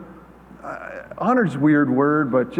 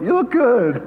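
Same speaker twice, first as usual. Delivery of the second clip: higher, laughing through the words; amused repeat; 8 semitones higher than usual. Pretend. Repeating the compliment to himself, laughing. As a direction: tease the compliment back with a laugh.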